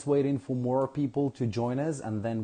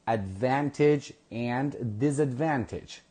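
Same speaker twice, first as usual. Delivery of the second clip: normal talking voice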